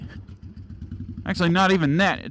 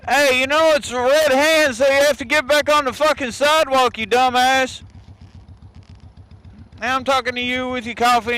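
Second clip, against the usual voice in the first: yelling at pedestrian; 6 semitones higher than usual. Real, not acted. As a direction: tell off the jaywalker.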